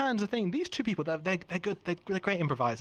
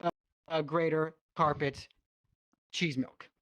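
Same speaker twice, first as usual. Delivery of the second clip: hard sell ad; 6 semitones higher than usual. Acted. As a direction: sell it like a TV salesman. Improv infomercial pitch.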